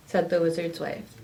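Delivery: ordinary delivery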